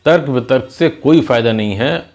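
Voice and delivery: usual speaking voice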